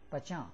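"pacha" with a nasal final vowel; nasalized ending. Shown as a demonstration. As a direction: let the last a of "pacha" ring through the nose. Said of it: "this is used to nasalize a syllable".

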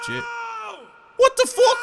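very high, loud outraged shout; shocked shout. Real, not acted. Gamer caught by the monster, shocked shout. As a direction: yell it in disbelief at being caught.

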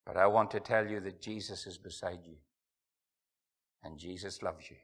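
hushed, tender closing plea; hushed appeal. Real, not acted. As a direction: lean in and speak low and tender. Soft close of altar call.

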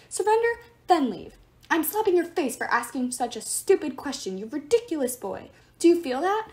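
loud, high pompous rant. Acted. Statue god ranting.

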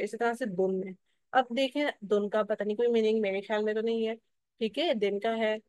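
normal talking voice

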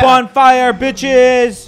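high, loud victory shout; triumphant yell. Real, not acted.

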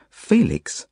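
put-on mildly cross girl's voice; understated scolding. A narrator's reading. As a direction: call the name with a touch of crossness. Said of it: "says Zelda crossly".

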